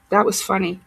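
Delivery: normal talking voice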